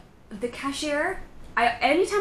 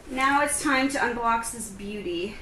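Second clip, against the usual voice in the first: ordinary delivery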